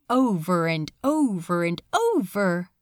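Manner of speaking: plain reading voice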